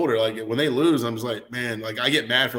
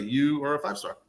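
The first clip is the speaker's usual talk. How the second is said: ordinary talk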